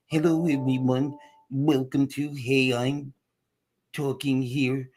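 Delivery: slow, drawn-out mock show welcome; mock low-energy opening. Host parodying his show opening after a call for enthusiasm, mock-slow. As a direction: stretch the words out with pauses between phrases. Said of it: "started talking like this".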